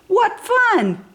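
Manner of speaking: high, gleeful squeal of fun; storyteller bursting with glee for the kids; delighted. A narrator's reading. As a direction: sound thrilled like a playful monkey. Narrator voicing George's delight.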